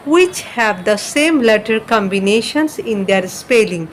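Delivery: slow, deliberate English dictation; slow read-aloud. Shown as a demonstration. Teacher reading the instruction to students, very slowly. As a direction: spell it out slowly for the class. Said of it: "অর্থাৎ কবিতাটার শব্দগুলোর".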